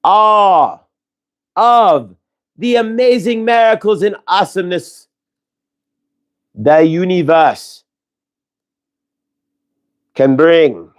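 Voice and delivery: channelling Saint Germain. Acted. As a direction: speak as a spirit giving a blessing, unhurried. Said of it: "thank you, Saint Germain, for the invocation there".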